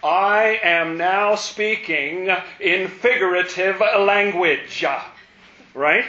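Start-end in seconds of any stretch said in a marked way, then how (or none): 0.0-4.8 slow emphatic quote. Shown as a demonstration. Re-quoting the same verse emphatically.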